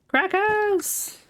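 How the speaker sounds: high delighted cry; excited find. Real, not acted. Gamer spots crackers in the loot, delighted squeal.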